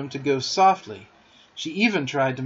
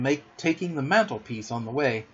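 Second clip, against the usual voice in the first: usual speaking voice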